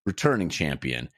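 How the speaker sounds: big announcer energy with high-pitched peaks; excited podcast opener; hyped show intro. Acted. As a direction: sell it like a ringside host, stretching key words.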